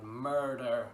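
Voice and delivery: a loud cry of murder; shouting servant. Retold or imitated. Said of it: "One cried".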